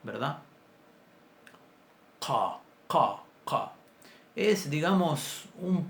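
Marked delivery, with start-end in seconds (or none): 2.2-3.7 "Qa" with the q deep in the throat, uvular. Shown as a demonstration. Teacher drilling the throaty "qa" sound for students. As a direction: repeat "qa" from far back in the throat. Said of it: "más atrás en la garganta".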